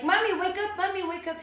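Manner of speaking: put-on a light kid voice begging mom to get up; imitating her son. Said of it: "he's like".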